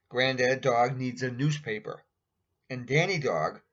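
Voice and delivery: ordinary delivery, nothing marked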